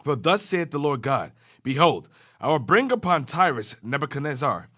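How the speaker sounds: usual speaking voice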